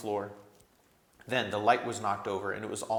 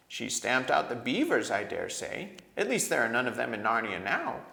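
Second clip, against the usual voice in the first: cold voice; 7 semitones higher than usual. A narrator's reading. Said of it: "in a cold voice".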